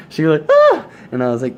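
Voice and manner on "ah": very high, loud shriek; imitated scream. Girl on the plane shrieking at the blood, very high and loud.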